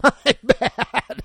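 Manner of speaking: apology through a laugh. Real, not acted. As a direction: laugh the words out.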